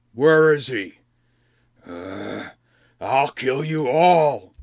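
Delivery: loud, slow, threatening growl; menacing goblin king. Acted. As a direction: threaten them all, dragging it out.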